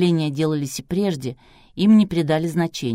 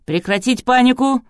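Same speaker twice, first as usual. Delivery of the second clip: loud, raised-pitch command; barked order; 6 semitones higher, 5 dB louder than usual. A narrator's reading. Producer silences the panicking studio, barking. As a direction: bark it to stop the panic. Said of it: "рявкнул продюсер".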